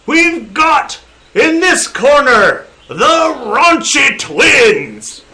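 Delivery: booming, slow ring announcer, pitched high. Acted. Gnome announcer calling contenders to the crowd, booming and drawn out. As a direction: draw it out like a wrestling ring announcer. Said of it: "And he says".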